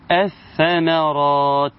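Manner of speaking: slow "الثمرات", lam merged into doubled ث; assimilated lam. Shown as a demonstration. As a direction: draw out "الثمرات" as ath-thamaraat. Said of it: "وعشان كده هيقول إيه؟".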